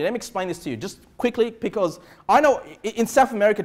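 ordinary talk, nothing marked

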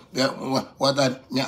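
ordinary delivery

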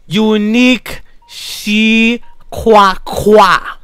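Unique Shi Kwa Kwa drawn out slowly, each syllable spaced. Shown as a demonstration. Teaching a rude kid his name, said very slowly. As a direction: spell the name out slow for the slow listener. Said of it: "I'll say it slow for you".

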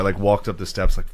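usual speaking voice

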